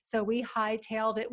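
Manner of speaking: ordinary talk, nothing marked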